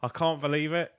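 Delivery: ordinary delivery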